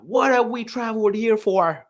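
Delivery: high, loud, half-hearted Irish outburst; attempted Irish accent. Irish customer complains at ticket counter, attempted Irish accent. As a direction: imitate stressed Irish fans, accent barely attempted. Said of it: "I can't even do an Irish accent".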